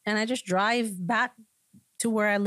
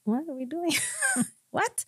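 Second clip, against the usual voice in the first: high, baffled disbelief with a laugh; bewildered; 10 semitones higher than usual. Retold or imitated. Retelling the church scolding, high baffled disbelief. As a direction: act stunned at the accusation. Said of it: "And I was like".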